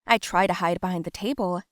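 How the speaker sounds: ordinary delivery, nothing marked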